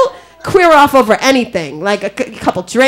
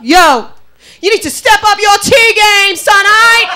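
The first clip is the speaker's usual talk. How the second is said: high, loud thug holler; acted scream; 8 semitones higher, 4 dB louder, slower than usual. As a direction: scream it out as a parting challenge. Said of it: "he screams out".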